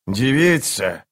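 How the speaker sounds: mildly hoarse call-out. A narrator's reading. Kruppe hails the undead woman, a mild hoarse call. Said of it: "хрипло окрикнул Круп".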